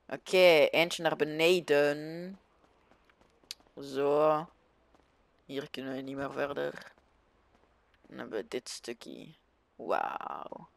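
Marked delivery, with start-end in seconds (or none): none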